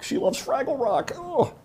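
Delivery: a hint of the giddy young wife; mock wife gushing. Mocking wife's taste.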